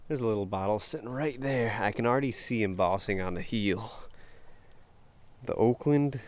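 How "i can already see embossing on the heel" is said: normal talking voice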